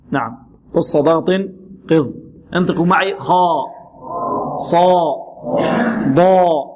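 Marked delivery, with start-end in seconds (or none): 3.2-6.7 خاء، صاء، ضاء thick and held, lips unrounded; isolated emphatic syllables for خاء، صاء، ضاء, each spaced out; heavy letters drill. Shown as a demonstration. Teacher leading students in repetition, heavy letters. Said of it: "بدون استدارة الشفتين".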